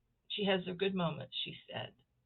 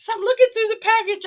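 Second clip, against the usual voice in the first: laughing; 15 dB louder than usual. Real, not acted. Amused story about strange card.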